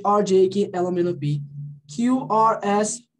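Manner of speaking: ordinary delivery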